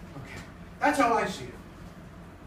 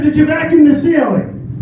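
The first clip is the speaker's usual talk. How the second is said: wife mocking; 5 semitones higher, 8 dB louder than usual. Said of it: "She says".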